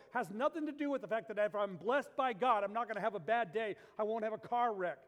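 impassioned preacher at full volume; full-force preaching. Real, not acted. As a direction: drive the point home to the congregation, high and loud.

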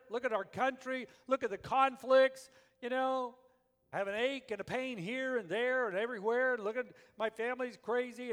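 loud, high preaching voice; preaching at full force. Acted.

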